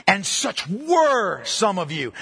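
slow, leaning on "were" with a fall; stress on were. Shown as a demonstration. Preacher reading scripture to the congregation, slowly stressing "were". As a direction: underline the word "were" as you read. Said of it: "Underline were".

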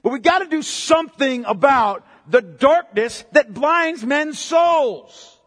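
loud, drawn-out preaching; slow down and hammer "blinds men's souls"; full-force preaching. Real, not acted. Preacher urging his flock to fight the darkness, loud and drawn out.

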